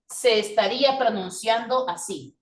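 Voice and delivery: ordinary talk, nothing marked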